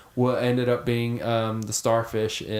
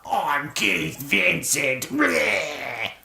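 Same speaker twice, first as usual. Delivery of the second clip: spooky voice; much slower than usual. Acted. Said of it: "Everyone's gotta say it in a spooky voice".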